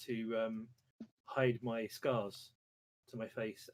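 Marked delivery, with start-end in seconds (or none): none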